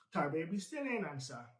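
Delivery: ordinary delivery, nothing marked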